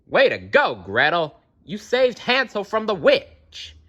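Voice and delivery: cheering. Real, not acted. Reader cheering Gretel for saving Hansel, excited. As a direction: cheer Gretel on, pitch jumping up.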